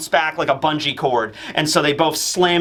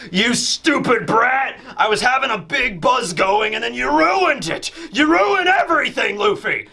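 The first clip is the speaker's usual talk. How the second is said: a hint of a drunk's mock rage, rising higher; angry drunk; 6 semitones higher than usual. Kaido yelling at Luffy for ruining his buzz, mild mock rage. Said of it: "this is his angry drunk phase".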